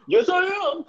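very high, goofy defiant squeak; hammed-up cartoon coach; Freddie Kitchens. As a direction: blurt it in a silly addled voice. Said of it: "And Freddie Kitchens goes".

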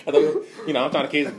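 laughing while talking. Real, not acted. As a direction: chuckle through the words. Three different trip agendas, laughing.

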